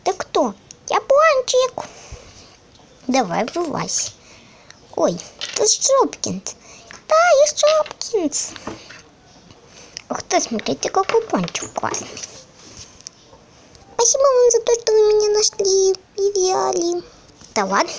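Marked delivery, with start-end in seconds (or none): 0.9-1.9 high slow little donut voice; slow and squeaky, like a tiny toy. Pretend. 7.1-8.5 high slow little toy voice; slow and squeaky, like a tiny toy. Pretend. 13.9-17.0 high grateful little toy voice; squeaky toy voice. Pretend.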